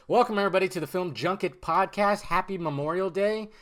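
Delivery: usual speaking voice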